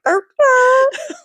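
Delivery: very high, silly dopey voice; idiot dog. Retold or imitated. Dumb Benji following Copper around, high idiot voice. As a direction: play a dimwit dog tagging along. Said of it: "an idiot and just, like, following Copper".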